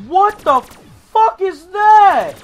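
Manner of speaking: loud, high, drawn-out scream of disbelief; alarmed shout. Real, not acted. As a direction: shout in shock at a sudden monster. Something came out of the ground.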